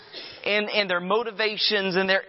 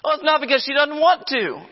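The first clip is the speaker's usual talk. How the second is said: sarcastic retort; 9 semitones higher, 3 dB louder than usual. Real, not acted.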